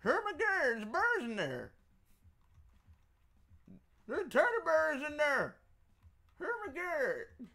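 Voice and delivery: put-on high, squealing little kid calling daddy; childlike voice. Pretending to be a kid showing daddy the toys, high squeal. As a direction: play an excited child tugging at daddy.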